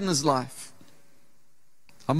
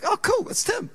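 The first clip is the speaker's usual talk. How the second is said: pleased surprise; 13 semitones higher than usual. Retold or imitated. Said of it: "I kinda see him coming and going".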